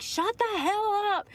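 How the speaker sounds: high, slow, furious scolding; angry mom yelling. Retold or imitated. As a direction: snap at a crying kid like an angry mother. Angry mom scolding crying child.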